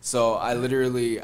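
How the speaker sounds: usual speaking voice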